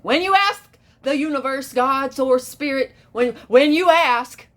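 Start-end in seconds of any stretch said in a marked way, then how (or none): none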